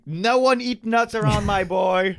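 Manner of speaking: high, slow, loud overprotective mom; overprotective parent. Pretend. Parent of nut-allergic boy warning everyone, hammed-up plea. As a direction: plead like a panicked mother.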